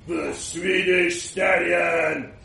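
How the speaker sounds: slow, drawn-out grand billing; grand announcement. Acted. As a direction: present The Swedish Stallion like a name in lights. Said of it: "waved his hand ... written in lights".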